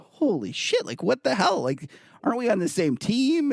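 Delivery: high-pitched, incredulous quote; retold disbelief. Trader recalls sitting stunned in a bar, mock disbelief.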